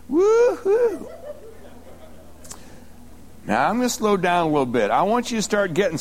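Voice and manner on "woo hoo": high "woo-hoo" whoop; excited whoop. Real, not acted. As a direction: let out a joyful high whoop. Preacher exults over the verse.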